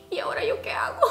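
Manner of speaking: high, breathy fake crying whimper; whiny mock cry. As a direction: whimper it like a helpless mock sob. Panicked inner reaction, retold.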